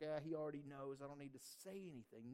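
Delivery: low, soft, offhand brush-off; dismissive. Acted. Believer assuming God already knows, quietly dismissive. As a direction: toss it away under your voice.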